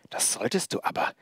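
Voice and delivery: whispery, venomous threat. A narrator's reading. Puppet threatening both intruders from everywhere, venomous voice. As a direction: start in a poisonous whisper. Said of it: "ihre giftige Stimme schien von überall zu kommen".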